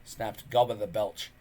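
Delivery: ordinary delivery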